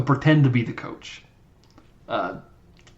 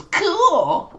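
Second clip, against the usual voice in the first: mock nerdy reply. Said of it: "And she goes".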